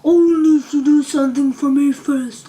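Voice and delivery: loud, harsh, otherworldly snap; snapping giant head. A narrator's reading. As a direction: cut her off with a loud snap. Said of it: "it snapped".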